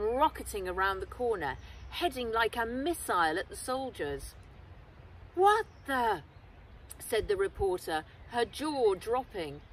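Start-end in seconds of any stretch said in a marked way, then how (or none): 5.3-6.2 startled. Acted. Reporter shocked by attacker.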